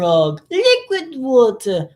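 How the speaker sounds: put-on high, slow British accent. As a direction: draw it out in a high-pitched British voice. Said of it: "with a British accent".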